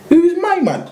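high incredulous challenge. Retold or imitated. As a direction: sneer it up in disbelief. Incredulous retort to the stranger.

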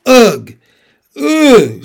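two loud, high groans of discomfort; shivering "ugh". A narrator's reading. Susan stamping her feet in the cold, loud discomfort. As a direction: exclaim "ugh" sharply, twice.

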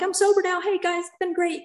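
high, chirpy mock cheer; mock breezy voice. Mocking a too-easy sobriety farewell, chirpy and high. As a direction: brush it off like recovery is done. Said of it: "it's not like".

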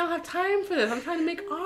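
high, soft comic whine; hammed-up complaint of an interrupted artist; Benedict. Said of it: "He said".

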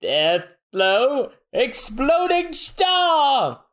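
drawn-out high battle cry; high attack shout. Acted. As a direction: yell the attack name slowly. Usopp attack call.

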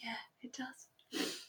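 slow hushed whisper between two friends watching the stars. Retold or imitated. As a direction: whisper it as if not to break the moment. Clary answers softly under the stars.

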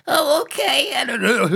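very high, cracking adolescent squawk; awkward pubescent boy. Acted. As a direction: imitate a boy whose voice is breaking. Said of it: "Your voice just starts to turn on you".

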